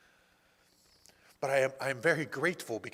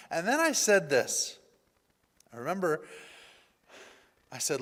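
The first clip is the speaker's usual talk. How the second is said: ordinary talk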